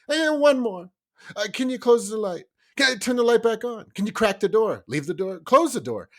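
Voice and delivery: put-on high pleading child, request after request; whiny kid voice. Dad imitating his kids stalling at bedtime, high and whiny.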